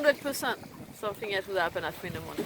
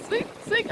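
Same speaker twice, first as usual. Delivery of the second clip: high squeaky voiced creak of the paddle; paddle creaking swing; 12 semitones higher than usual. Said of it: "the paddle goes".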